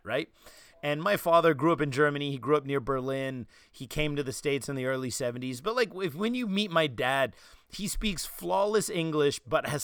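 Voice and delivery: ordinary talk, nothing marked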